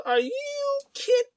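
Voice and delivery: very high, drawn-out outrage; hammed-up incredulous cry; mock disbelief. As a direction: squeal it slowly in exaggerated disbelief. Acting out outrage at two-count.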